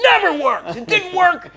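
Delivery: high, loud furious shouting; angry yell. Retold or imitated. Mad sergeant shouting about his gear. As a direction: rage at the broken gear. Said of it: "he was getting so mad".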